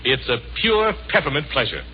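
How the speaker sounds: ordinary talk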